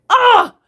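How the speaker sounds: sudden high loud squeal of delight; delighted shriek. Real, not acted. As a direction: squeal at how good they look. Admiring her fresh nails.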